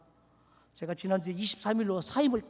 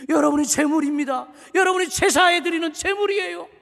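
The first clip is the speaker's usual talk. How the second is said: loud, high preaching at full force; fiery preaching; 7 semitones higher, 9 dB louder than usual. Real, not acted. Pastor urging the congregation to die to self, shouting sermon. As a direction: thunder it out to the pews.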